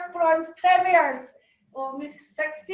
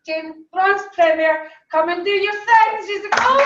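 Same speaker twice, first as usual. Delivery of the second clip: announcer call; 3 semitones higher, 9 dB louder than usual. Real, not acted. Host calling the award winner up on stage, loud announcer call.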